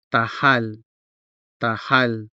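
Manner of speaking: tahal with a clearly sounded h. Shown as a demonstration. Hiligaynon tutor models the word, clearly sounded h in tahal. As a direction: say tahal, h fully audible in the stressed syllable. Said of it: "and so it is clearly sounded".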